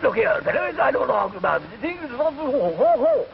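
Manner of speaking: high, plummy English bluster; a toff huffing at the waiter; posh English accent. Retold or imitated. Englishman blustering at the waiter, plummy posh accent. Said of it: "Man said".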